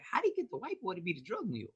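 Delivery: high, soft, rapid-fire mock questioning; mock onlookers. Host imitates people puzzling over the story, mock onlookers. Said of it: "We'd be like".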